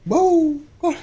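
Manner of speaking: বাউ বাউ sung out high and slow to coax the dog; high, loud bark prompt. Shown as a demonstration. Coaxing the dog to bark, high drawn-out বাউ. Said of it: "একটু বাউ বাউ কর".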